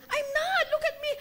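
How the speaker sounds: high, pleading "I'm not"; insistent denial. Retold or imitated. Mother protesting to her son, high insistent denial. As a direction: protest a little too hard. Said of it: "Rafa started telling me".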